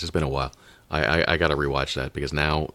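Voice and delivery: usual speaking voice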